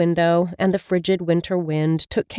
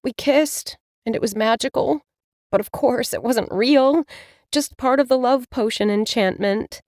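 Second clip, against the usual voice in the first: higher voice, a touch sad; wistful young witch; 6 semitones higher than usual. Acted. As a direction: confess it, already resigned. Mila confides in her cat.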